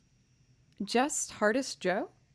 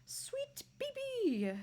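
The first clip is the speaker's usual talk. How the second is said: high whisper; 8 dB quieter, much slower than usual. Real, not acted. Repeating the dog's name.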